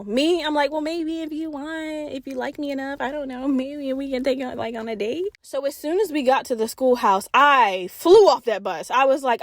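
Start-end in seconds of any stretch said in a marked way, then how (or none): 0.7-5.3 softer, hesitant, playfully shy; coy soft voice. Retold or imitated. Coy reply to her own fantasy.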